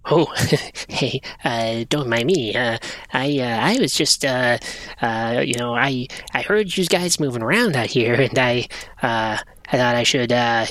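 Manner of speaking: halting, low and soft creature voice; furtive little creature. Acted. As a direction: sheepishly stumble through an explanation with lots of uhs. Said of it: "He looks up and he goes".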